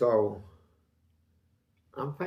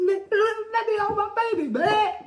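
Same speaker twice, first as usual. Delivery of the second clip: very high, loud pleading; 6 dB louder than usual. Retold or imitated. Begging his partner to let him hold the baby, very high.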